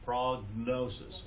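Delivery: "Prognosis" drawn out slowly, G sounded before N; G and N together. Shown as a demonstration. Teacher showing the class G and N together, slow deliberate "Prognosis". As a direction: slowly separate "Prognosis" so both G and N are heard. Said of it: "hear the G and the N said together".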